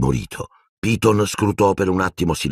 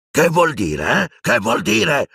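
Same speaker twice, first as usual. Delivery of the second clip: high-pitched angry demand, rising higher; violent yell; 12 semitones higher, slower than usual. A narrator's reading. Death Eater challenges Silente, suddenly violent yell. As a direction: snap the question out, then repeat it higher. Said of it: "urlò il mangiamorte a un tratto violento".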